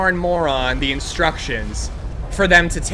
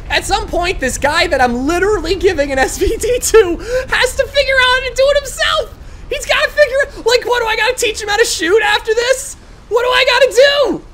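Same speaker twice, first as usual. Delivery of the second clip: exasperated laughing rant; 13 semitones higher, 4 dB louder than usual. Real, not acted.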